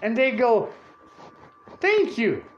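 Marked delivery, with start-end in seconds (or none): 1.8-2.4 high-pitched mock gratitude; delighted thanks. Security guard thanking him for free tickets, delighted. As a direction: gush it, imitating a thrilled guard.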